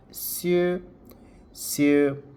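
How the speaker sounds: "sieu" said like sue, repeated; French sur. Shown as a demonstration. Teacher modelling the word for students. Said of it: "now you can see like sieu".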